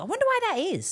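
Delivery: mock innocence.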